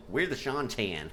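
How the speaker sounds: mock Texan accent. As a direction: ham up a Texas twang. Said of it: "You never hear American accents".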